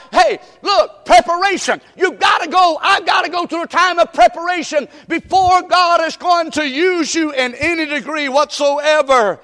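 high, loud, driving sermon delivery; full-force preaching. Real, not acted. Preacher urging a congregation through preparation, preaching at full force. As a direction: shout the point home to the congregation.

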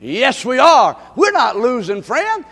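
usual speaking voice

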